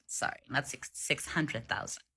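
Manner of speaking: ordinary delivery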